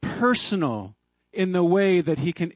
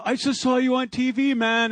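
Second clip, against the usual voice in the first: excited friend; 7 semitones higher than usual. Retold or imitated. Friends calling about TV.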